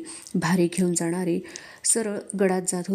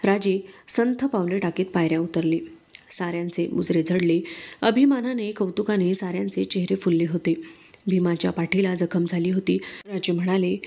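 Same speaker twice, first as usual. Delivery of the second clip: plain reading voice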